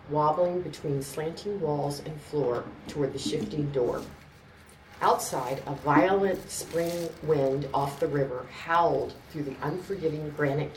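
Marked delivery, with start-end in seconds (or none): none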